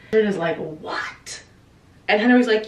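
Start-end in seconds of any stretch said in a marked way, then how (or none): 0.6-1.4 hushed, voiceless disbelief; mock stunned. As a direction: imitate a shocked near-whisper. Richard reacts to Henry's confession.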